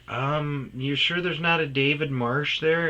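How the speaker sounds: lightly voiced worried mother; mom's voice. Mom on phone, voiced higher.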